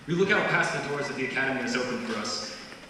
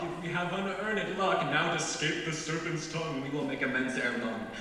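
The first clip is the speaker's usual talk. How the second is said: lilting, higher-pitched verse delivery; dramatic Shakespeare recitation; 4 semitones higher than usual. Acted. Graduate performing Puck's monologue from memory, theatrical recitation. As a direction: recite it as a theatrical closing speech.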